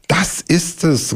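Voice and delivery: high, slow, drawn-out eureka; excited cry. Acted. Bear hearing the blackbird sing, high and delighted. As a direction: stretch it out with sudden delight. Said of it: "rief der Bär".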